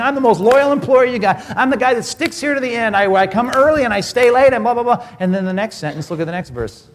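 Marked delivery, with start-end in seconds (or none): 0.0-5.1 raised, louder bragging to the boss; boastful employee. Retold or imitated. Employee boasting to his boss, louder and raised. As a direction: brag about your loyalty to the boss. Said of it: "like marching into your boss's office and saying".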